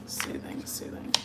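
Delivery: soft unvoiced whisper. Real, not acted. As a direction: breathe it out soothingly. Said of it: "whispers word softly".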